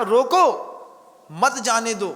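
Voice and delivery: रोको on its own, pause, then मत जाने दो together; comma after रोको. Shown as a demonstration. Preacher showing his intended meaning, pause after रोको. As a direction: break straight after रोको, run मत into जाने दो. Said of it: "कामा लगाना था रोको के बाद".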